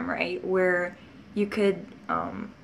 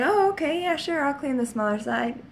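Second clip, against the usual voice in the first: a high, chirpy yes from the big sister; eager sister; 7 semitones higher than usual. Retold or imitated. Older sister happily agreeing to the worse half of the room, chirpy. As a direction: agree eagerly, falling for the trick.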